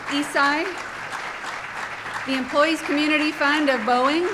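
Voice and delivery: each sponsor name called out loud; loud sponsor callout. Real, not acted. Host thanking sponsors over applause, loud callout. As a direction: boom each name across the room.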